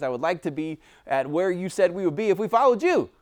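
worked-up believer listing what he wants from God; frustrated wish list. Retold or imitated. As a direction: rattle off demands, getting worked up. Ranting his wish list to God.

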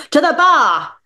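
triumphant. Acted. Drunk dad announces the bar to the table, triumphant and loud. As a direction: announce it like a victory. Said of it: "his voice raised in triumph".

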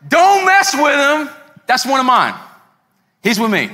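high, loud protective warning, easing down; imitated stretched-out call; Saliba. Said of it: "I got Saliba going".

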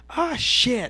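high, loud imitation of a little kid; little child cussing. Retelling a kid overheard outside, high mock child voice. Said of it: "a little child voice was just said".

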